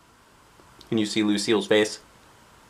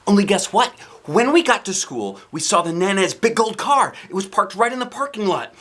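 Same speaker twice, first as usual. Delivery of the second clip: excited narration; 8 semitones higher, 4 dB louder than usual. A narrator's reading. Lively narration.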